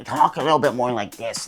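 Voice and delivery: talking through a blocked nose; as if you have a cold; stuffed-up nasal voice; stuffy nose. Shown as a demonstration. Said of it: "stuffy nose ... like this".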